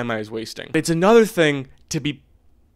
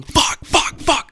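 high, strained, half-voiced cries of fuck; reliving a frustrated scream, high and strained; strained scream at the sky; 5 dB louder, much slower than usual. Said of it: "looking at the sky going".

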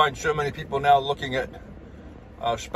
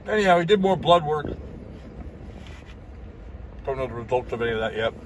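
talking with food in mouth; eating between sentences; chewing; 3 dB quieter than usual. Real, not acted. Seen 0: Eats salad between bites; lips work as if chewing while talking.

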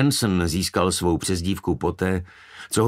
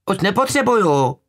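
put-on very high, loud child's call; high-pitched holler in reply; calling back; 14 semitones higher, 6 dB louder than usual. A narrator's reading. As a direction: shout back in a small high voice. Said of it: "zavolala nazpátek".

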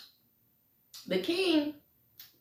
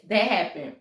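plain reading voice

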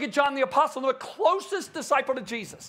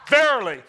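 "Verily" punched loud; loud, weighty "Verily"; emphatic proclamation; 7 dB louder than usual. Acted. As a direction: hit "Verily" hard, as an announcement. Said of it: "want to emphasize a word".

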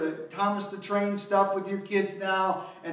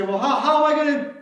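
flustered asking; 7 semitones higher, 8 dB louder, faster than usual. Retold or imitated. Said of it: "And then we say".

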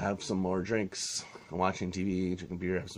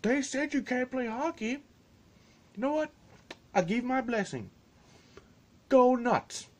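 high, mocking dad voice; a hammed-up hockey dad egging the kids on; mock belligerent dad. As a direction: ham up the loudmouth dad. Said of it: "the dad is like".

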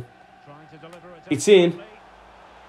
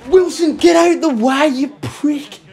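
high, loud yell at his own player; real furious outburst; angry shout; 12 semitones higher, 4 dB louder than usual. Real, not acted. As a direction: bark him out of the way. Player blocks the shot.

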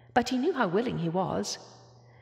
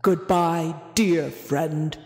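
low, loud, rumbling farewell; rumbling whale; 3 semitones lower, 5 dB louder, much slower than usual. A narrator's reading. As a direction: rumble it like a huge sad whale. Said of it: "rumbled Boris".

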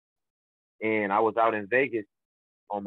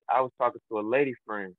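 normal talking voice

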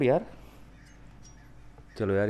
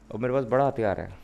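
ordinary talk

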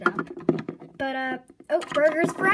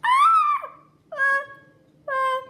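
shrieks; 11 semitones higher, 9 dB louder, much slower than usual. Acted. Hot sauce dumped on Ginger.

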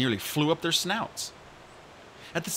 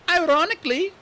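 put-on high, bright young dragonette voice; chirpy excited young dragon; eager little RainWing voice; Kinkajou's voice; 7 dB louder than usual. Kinkajou pipes up.

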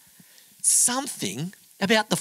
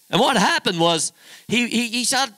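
normal talking voice

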